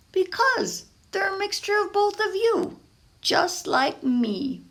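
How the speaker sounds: a sweet, proud reveal; heartfelt boy. Acted. Pablo telling Papa why he chose jalapeño bagels, tender.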